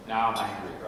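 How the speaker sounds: ordinary talk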